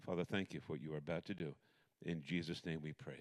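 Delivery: soft, low opening prayer; hushed pastor's prayer. Real, not acted. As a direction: pray quietly and reverently. Opening prayer before sermon.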